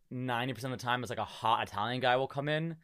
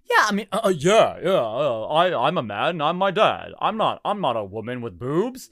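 Mulan faking a man; 2 semitones higher, 8 dB louder than usual. Retold or imitated. Mulan pretending to be a man in conversations, hammed-up macho voice. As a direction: stammer out a bad fake tough guy. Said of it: "Mulan is pretending to be a man".

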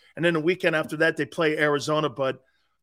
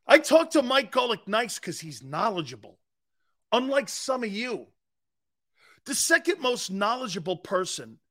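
ordinary talk, nothing marked

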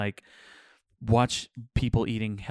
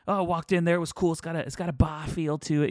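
higher-pitched mock New England reviewer; imitated New England accent; 6 semitones higher than usual. Quoting pizza reviewer on the bar feel, imitated New England accent. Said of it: "he's kinda got this thick accent".

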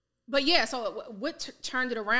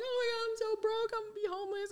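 high, soft mock wail of despair; imitating crying; 12 semitones higher, 7 dB quieter than usual. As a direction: replay the panicked sob to mom. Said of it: "busted out crying".

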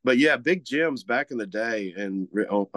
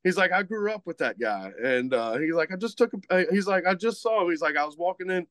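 light imitation of a Cajun slur, pitched higher; Cajun accent; 7 semitones higher than usual. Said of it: "I'm trying to, uh, emulate his accent".

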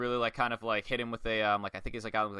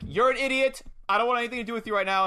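higher, louder mock outrage; angry retort; 7 semitones higher, 4 dB louder, faster than usual. Retelling a wrestler telling Money off, angry retort. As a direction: brush someone off angrily, hammed-up.

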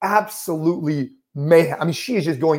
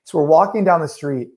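usual speaking voice

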